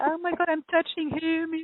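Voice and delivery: high, giddy squeal at normal volume; starstruck. Retold or imitated. Recalling shaking the commander's hand, starstruck. Acts out awe at the handshake.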